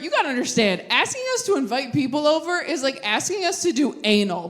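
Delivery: ordinary delivery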